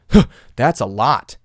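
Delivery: slow, skeptical drawl; doubting voice. Retold or imitated. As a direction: sound unconvinced. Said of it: "in a doubting voice".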